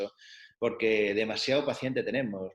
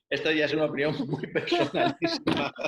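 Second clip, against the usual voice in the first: laughing; 4 semitones higher, 5 dB louder than usual. Real, not acted. Both laughing at his aside.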